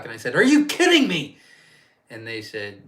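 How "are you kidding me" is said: high, loud disbelieving yell; retold yell. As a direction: shout it in outraged disbelief. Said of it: "Don't yell at me".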